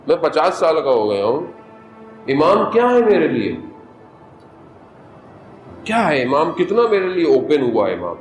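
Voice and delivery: ordinary delivery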